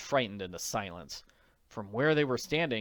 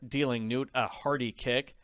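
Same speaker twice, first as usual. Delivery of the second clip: ordinary delivery, nothing marked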